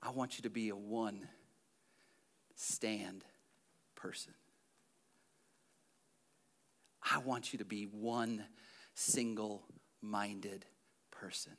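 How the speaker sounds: soft, slow, near whisper; hushed appeal. Acted. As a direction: drop to an intimate whisper. Intimate appeal after loud preaching.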